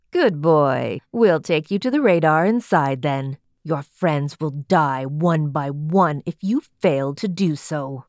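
low, menacing guard voice; threatening guard. A narrator's reading. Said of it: "he threatened".

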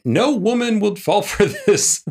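high, laughing scoff; laughing mock quote. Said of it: "you did say".

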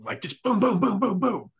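loud, high booming gun noises; gunshot sounds. Retold or imitated. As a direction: fire off boom after boom. Imitating muskets firing nonstop.

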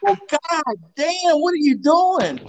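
hammed-up old coach, slow and high; Lou impression. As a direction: drag each word up high, teasing. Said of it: "Lou was like".

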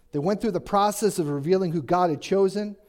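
ordinary talk

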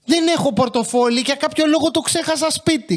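a cheeky customer's pretend apology; mock innocent excuse. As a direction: act like you forgot your wallet on purpose. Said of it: "και θα τους πω".

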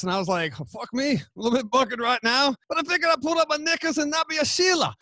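put-on broad Aussie pub-talk accent; put-on Australian accent. Guest spinning a pub story for the host, mock Australian accent. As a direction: ham up an Australian accent, pitch climbing at the end. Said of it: "Your accent's great".

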